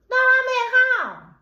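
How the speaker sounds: put-on defensive boyfriend. Boyfriend caught lying to girlfriend, high mock defensive. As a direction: squeak out a guilty denial.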